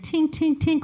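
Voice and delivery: light high plinks; ring tinkling. Retold or imitated. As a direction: imitate the ring bouncing. Imitating ring bouncing.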